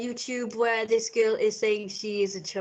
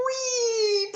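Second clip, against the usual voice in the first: high, gleeful whee; swinging the backpack at someone, gleeful; playful whee; 13 semitones higher than usual. Shown as a demonstration. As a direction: squeal it as the bag swings. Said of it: "I just go".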